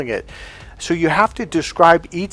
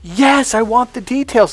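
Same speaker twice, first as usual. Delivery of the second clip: high, insistent female impression; emphatic women, imitated; 8 semitones higher than usual. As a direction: imitate women demanding it, pitched up. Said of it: "women have said".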